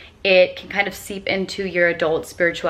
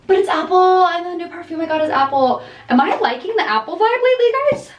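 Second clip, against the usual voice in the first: gasping, high-pitched burst of excitement; excited realisation; 10 semitones higher, 4 dB louder, faster than usual. Real, not acted. Vlogger realises her new perfume is apple too, excited. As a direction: gush in a sudden high, quicker rush.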